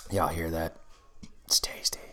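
hushed whisper to the mic. Real, not acted. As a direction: breathe it out in a whisper. Savoring drink, hushed aside.

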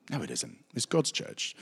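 soft, half-whispered comeback; hushed retort. Retold or imitated. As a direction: slip the comeback in quietly. Steph's retort in story.

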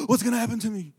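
high, loud frightened cry; cartoon dad in sudden fear; panicked Homer.

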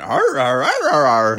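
harder, overdreven grommende "Arr, arr" van een acteur; imitating garbled accent. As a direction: brom het spottend na als een onverstaanbaar accent. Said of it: "he just went".

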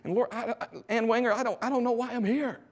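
put-on high, wavering old lady voice; a hint of a frail ninety-two year old; elderly woman voice. Old mentor woman speaking to young man, high old lady voice. Preacher voicing elderly Ann Wanger.

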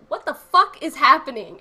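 loud, incredulous blurt; baffled outburst. Retold or imitated. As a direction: burst out in sudden disbelief. Acting out a viewer's sudden bafflement.

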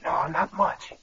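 soft, hoarse head-cold voice; faked hoarse voice. Impostor passing as Jim's sick nephew, faked hoarse voice. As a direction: fake a sore throat, keeping it quiet. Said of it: "This cold's made me hoarse".

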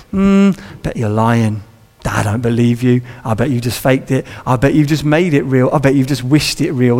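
low, sceptical inner voice; suspicious cynicism. Acted. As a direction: think it to yourself, low and doubting.

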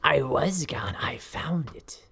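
unvoiced conspiratorial whisper; crazed whisper. Professor whispering her discovery to a student. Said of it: "You sounded a little crazy there".

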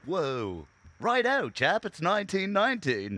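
mock old English. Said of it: "Do you think people were like".

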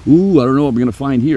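mock the researchers' doubt before the trip; doubtful researchers. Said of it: "the researchers are going".

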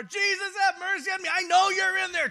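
high, loud pleading cry through a door; desperate shout. Acted. Woman pounding at the door, shouting to Jesus. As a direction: yell to be let in, desperate.

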